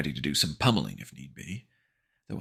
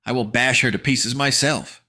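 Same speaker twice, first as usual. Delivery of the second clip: put-on higher, louder boyish bravado; brave boast; 8 semitones higher, 8 dB louder than usual. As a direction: puff up and boast.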